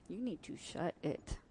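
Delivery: ordinary delivery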